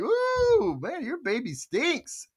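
high, drawn-out "ooh", then slow complaint; mock disgust. Blaming the baby in a crowd, mock disgusted. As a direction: exaggerate the stink, slowly. Pretends to blame a baby's stink.

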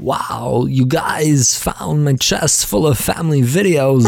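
slow it down and pause oddly like Walken; Christopher Walken impression. Creature thanking the family for finding his home movies, in a Walken impression. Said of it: "apparently he's Christopher Walken also. That's how he spoke".

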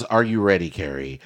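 ordinary talk

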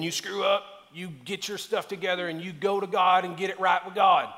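put-on a folksy American country accent; mock a down-home country preacher in a country accent. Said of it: "I don't know why it's in a country accent".

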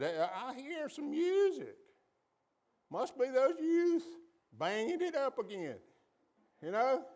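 Joshua's voice. Retold or imitated. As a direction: play Joshua as excited and lively, pitched high. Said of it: "Joshua said".

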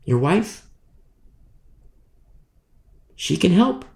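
usual speaking voice

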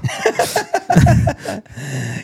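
real amused laughter. Real, not acted. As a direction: laugh it off after owning up. Laughs after admitting stupidity.

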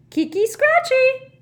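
happy, very high poltergeist cry; Peeves. Acted. Poltergeist egging on the wrestling elves, gleeful high cry. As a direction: cheer the fight on, loud and drawn out. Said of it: "cried Peeves happily".

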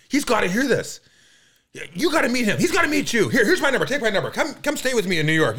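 loud, high, gushing imitation; excited insistence. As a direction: play an over-eager new friend insisting. Acts out man's excited insistence.

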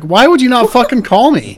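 a retold angry outburst at the careless mom. Mother confronting the other mom, retold indignant outburst.

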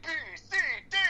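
hammed-up nutty yuppie voice; imitating Nicolas Cage. Impersonating the crazed man berating his psychiatrist, in a hammed-up voice. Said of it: "he goes".

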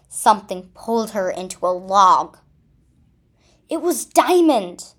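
usual speaking voice

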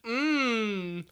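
eating while talking; mouth full. Real, not acted. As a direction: talk through a bite of burger. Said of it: "[name]'s holding a Big Mac in his hand".

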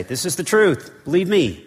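firm prophetic proclamation; prophet's declaration. Retold or imitated. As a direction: declare it like a prophet who can be trusted. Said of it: "A prophet would say".

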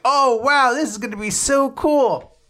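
high, gushing excitement, acted out; excited kid. Girl handed a lollipop, acted excited. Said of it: "runs away all excited".

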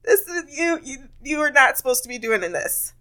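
high, shocked outburst that fades down; mock shocked housekeeper. Housekeeper scolding guest for cleaning, mock shocked high outburst. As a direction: gasp it out in mock horror. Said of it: "It's like".

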